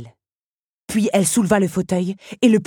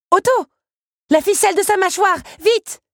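high, loud shouted command; urgent order; 7 dB louder than usual. A narrator's reading. Belle orders Otto to cut the jaw string, urgently shouting. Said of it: "ordonna-t-elle".

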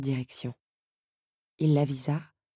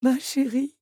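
high, loud emotional cry; overcome father; 11 semitones higher, 4 dB louder than usual. A narrator's reading. Father greets his daughter, high and overcome with joy. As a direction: pour out his relief, much higher.

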